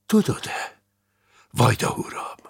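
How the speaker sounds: breath-heavy, hushed question; whispery, confidential query; near whisper. Acted. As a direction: ask it almost in a whisper. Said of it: "maga is szinte suttogva".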